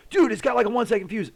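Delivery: high, loud, urgent warning; retold panicked warning from the buddy; alarmed warning. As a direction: yelp the warning at your buddy. Buddy warns of short fuse.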